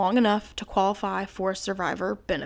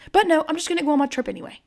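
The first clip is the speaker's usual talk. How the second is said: high, fast mocking voice; mocking mimicry; 6 semitones higher, 3 dB louder, much faster than usual. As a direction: mimic someone breezily brushing it off. Mockingly voices the mother's attitude.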